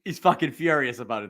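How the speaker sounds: ordinary talk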